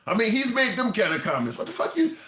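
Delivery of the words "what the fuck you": shocked outburst. Retold or imitated. Repeats the shocked reaction.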